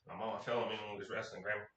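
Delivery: soft, hurried timid mumble; a touch of a frightened boy covering for mom; meek scared kid. Retold or imitated. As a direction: recite the lie fast and small. Scared kid repeating the lie.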